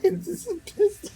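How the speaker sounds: high, squeaky laughing talk; cracking up mid-sentence. Real, not acted. Cracks up at angry Peter.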